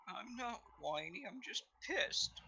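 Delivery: soft, slow, sulky whine; whiny voice. Acted. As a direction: sulk from curled up in a ball. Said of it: "Wedge kinda curls up into a ball".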